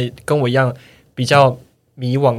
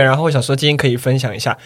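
ordinary talk, nothing marked

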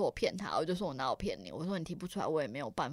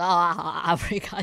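mock agreement; 9 dB louder than usual. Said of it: "然后我还想说".

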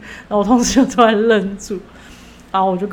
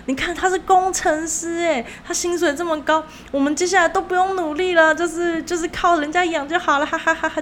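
higher, fast-talking nervous coworker pushing a date; 紧张的同事; 8 semitones higher than usual. Retold or imitated. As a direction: gush about the engineer to change the subject, voice pitched up. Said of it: "然后我同事又很紧张". (模仿同事紧张地推销男生，音调偏高)

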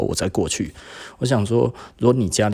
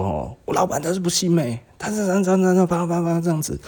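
higher, mock-harsh rant on the phone; imitating a fierce phone call; 9 semitones higher than usual. Employee complaining about his boss to his aunt, mock fierce rant. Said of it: "怎么讲得那么凶".

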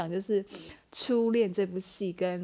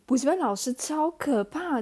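higher and louder, rising at the end on 补习班; exaggerated fright; 6 semitones higher, 5 dB louder than usual. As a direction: stress 补习班 and make 超可怕 sound scary. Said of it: "补习班老师很可怕欸".

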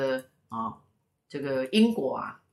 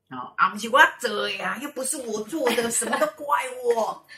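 higher, lightly voiced whiny denial; lightly voiced culprit denying guilt, higher and louder; mock protest; 8 semitones higher, 3 dB louder than usual. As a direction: play someone wriggling out of the blame. Voicing the blamed culprit.

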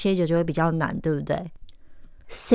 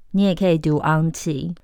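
ordinary talk, nothing marked

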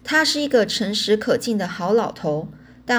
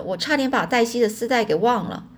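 usual speaking voice

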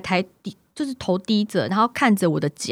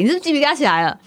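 ordinary delivery

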